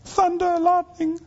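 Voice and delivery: put-on high small voice of a frightened child; scared little child. Dad voicing his scared little daughter at bedtime, high and childlike. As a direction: imitate a frightened little girl, pitched high. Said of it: "she'll say".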